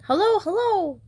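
high squawky parrot calling hello; eager parrot. A narrator's reading. The parrot greets Jonathan eagerly from its perch, high squawky call. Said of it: "the parrot called eagerly".

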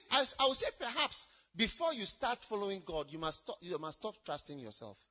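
ordinary talk

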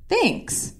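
soft, high-pitched "thanks"; imitated reply. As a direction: toss out a high, small thanks. Quoting boyfriend's unexpected reply.

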